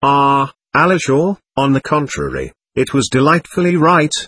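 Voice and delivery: ordinary delivery, nothing marked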